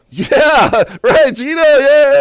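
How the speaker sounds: very high, loud cheering yell; crowd erupting. Retold or imitated. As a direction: burst out like a whole crowd after a goal. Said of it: "it was, like, quiet for a second".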